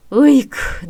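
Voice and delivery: ordinary delivery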